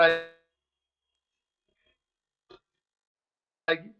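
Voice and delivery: glitchy stretched phone audio; time-smeared voice. Real, not acted. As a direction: voice dragged out by a bad line. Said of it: "अटक रही है।".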